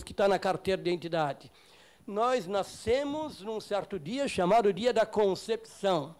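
ordinary talk, nothing marked

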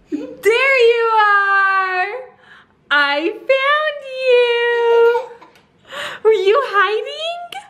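very high, drawn-out singsong; playful baby talk. Pretend. Mom finds her hiding toddler, high playful singsong. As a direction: squeal it slowly to a little one, stretching the vowels.

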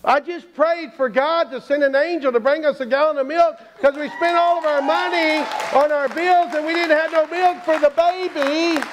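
high, emotional outpouring; overwhelmed wife. Acted. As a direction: pour out the answered prayer, drawing out the end. Wife explains her answered prayer.

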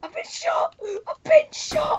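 high, strained yelp of fake pain; mock panic. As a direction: squeal it like you just got hit. Player hit in game, crying out.

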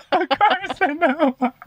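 high, drawn-out words through laughter; helpless cackling on the word; laughing hard. Real, not acted. Cracks up at the misused word.

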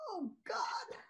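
breathless, whispered horror; horrified gasp. Acted. Grace walks in on the bed scene, whispering in horror. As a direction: choke it out in a shocked whisper. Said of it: "horrified by what she sees".

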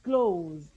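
"closed" held long and slow; term drawn out. Shown as a demonstration. Teacher naming these syllables, "closed" drawn out. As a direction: stretch out "closed" for the class. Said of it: "What do we call them?".

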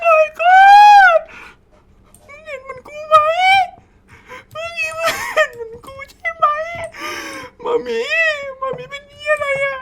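very high squealing panic; terrified shriek. Real, not acted. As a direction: squeal in pure fright. Terrified at monster mom. (กรีดร้องเสียงแหลมสุดกลัว)

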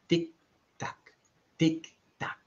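soft, crisp tick-tock; ticking clock. A narrator's reading. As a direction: tick evenly like a clock, each syllable separate.

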